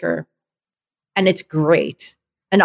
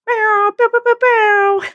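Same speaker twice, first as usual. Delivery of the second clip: high, breathy little dog yips; yappy panting puppy; slower than usual. Pretend. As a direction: yip like a tiny excited puppy. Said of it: "get that as a drop-in sound".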